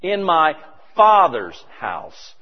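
"Father's" drawn out very slow and loud; "In my Father's house" spoken slowly with "Father's" punched; stress on FATHER'S. Shown as a demonstration. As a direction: linger on "Father's", weight on every sound. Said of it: "the emphasis is on Father".

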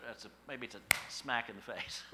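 usual speaking voice

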